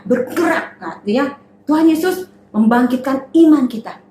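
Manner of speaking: plain reading voice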